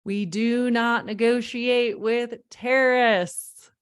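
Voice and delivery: slow, loud, every word weighted; emphatic rule. Shown as a demonstration. Coach to parents, stating the rule firmly and slowly. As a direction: lay down the law, slowly.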